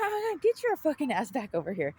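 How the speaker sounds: high, sharp demand; angry callout. Retold or imitated. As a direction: yell him back over. Yelling at man walking away.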